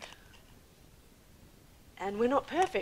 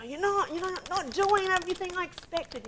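high, louder accusing complaint; mock complaint; 9 semitones higher, 5 dB louder than usual. Wife retelling her complaint to her husband, mock nagging. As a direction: imitate a dissatisfied spouse, pitched well up. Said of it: "It was like".

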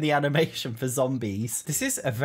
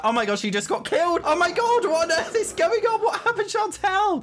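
rising into a high, loud shriek; shocked outcry; 5 dB louder than usual. Real, not acted. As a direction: cry out in mounting disbelief. Sees character killed in game.

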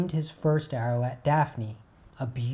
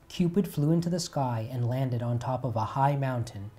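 ordinary delivery

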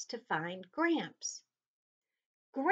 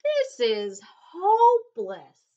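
loud, exasperated sigh of hopelessness; 3 semitones higher, 12 dB louder than usual. A narrator's reading. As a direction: give up with a heavy sigh. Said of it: "sighed Marta".

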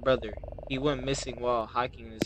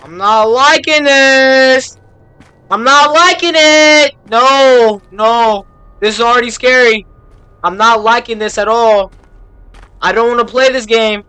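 frightened shriek; 10 semitones higher, 17 dB louder than usual. Real, not acted. Gamer walking the dark path, shrieking in fear. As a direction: wail in real fear.

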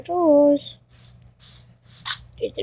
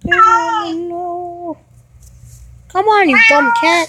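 loud exasperated call to the cat; frustrated shout; 3 semitones higher, 7 dB louder, slower than usual. Real, not acted. Owner yelling at the cat that won't come, loud and fed up. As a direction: yell at the stubborn cat.

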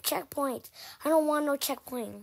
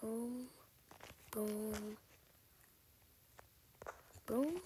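ordinary talk, nothing marked

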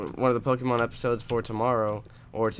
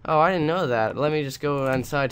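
louder, higher mock astonishment; sarcastic surprise; 5 semitones higher, 5 dB louder than usual. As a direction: play dumb, as if this is stunning news. Mock surprise at obvious remark.

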